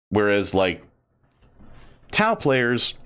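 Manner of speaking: ordinary talk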